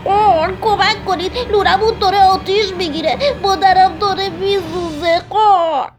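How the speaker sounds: high, loud cawing cry for help; frantic little crow voice; panicked young crow. A narrator's reading. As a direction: caw and call out in alarm. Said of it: "بچهٔ کلاغه با عجله خودشو به دوستاش رسوند".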